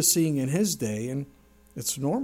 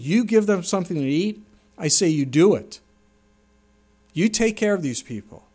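louder, insistent command with stress on You; emphatic command; 3 semitones higher, 7 dB louder than usual. Acted. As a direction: press each phrase as a firm order. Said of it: "it's emphatic".

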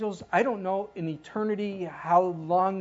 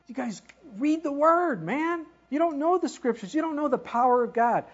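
fervent scolding; 8 semitones higher, 4 dB louder than usual. Real, not acted. Preacher scolding readers of Scripture.

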